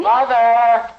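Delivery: loud call from upstairs; yelling down the stairs. Acted. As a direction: shout for mother from the top of the stair. Son calling from upstairs.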